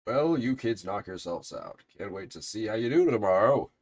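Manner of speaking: loud, hearty send-off in a detective's voice; boisterous detective. Acted. Detective seeing the kids off before tomorrow, boisterous. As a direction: play the bombastic detective cheering them on.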